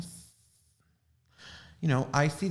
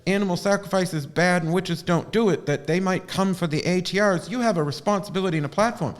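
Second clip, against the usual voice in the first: louder, higher lecturing mock voice; imitating her friend; 7 semitones higher, 5 dB louder than usual. Friend lecturing her about responsibility, mock lecture. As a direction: play the friend scolding her. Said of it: "he goes".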